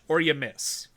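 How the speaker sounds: ordinary delivery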